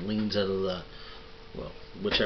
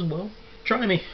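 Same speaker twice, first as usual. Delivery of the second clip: put-on old vendor's dare; 11 semitones higher than usual. Old vendor dares the pissed-off dude, high and teasing. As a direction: jump far higher in pitch, teasing old man.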